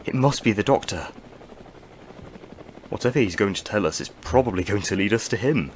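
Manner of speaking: normal talking voice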